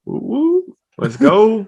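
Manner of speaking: high excited woo; hype cheer. Real, not acted. Hyped response to welcome.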